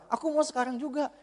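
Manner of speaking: put-on high, soft little-kid whine; whining child. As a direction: quietly insist like a child in a mall. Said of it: "anak kecil itu ya".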